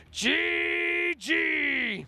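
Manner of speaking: shrill hype scream, very high. Retold or imitated. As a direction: yell it like a hyped caster. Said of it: "holographic head screaming".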